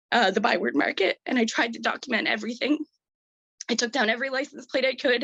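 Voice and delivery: real upset, fighting back tears while telling it; close to crying. Real, not acted. Resident recalls losing all hope after that day, close to crying. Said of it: "sorry if I cry".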